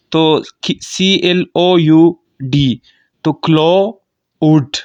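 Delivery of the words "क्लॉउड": क्लॉउड slowly split as "klo... ud"; diphthong split. Shown as a demonstration. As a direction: glide audibly from "o" to "u" in क्लॉउड, drawn out. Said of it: "एक vowel से मैं दूसरे vowel की तरफ गया". (क्लॉउड को धीरे से "क्लो... उड" तोड़कर)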